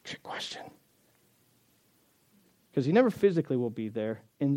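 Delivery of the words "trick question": hushed, voiceless whisper; whispered aside. Real, not acted. Pastor tipping off congregation after pop quiz, whispered. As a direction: slip it in under your breath.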